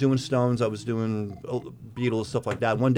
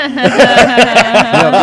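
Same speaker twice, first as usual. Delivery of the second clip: laughing; 11 semitones higher, 18 dB louder than usual. Real, not acted.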